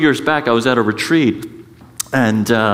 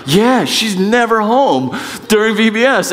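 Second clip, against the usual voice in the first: higher, whiny put-upon husband; mock whiny complaint; 8 semitones higher than usual. Associate pastor griping that his wife is never home, whiny. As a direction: whine it like a neglected husband.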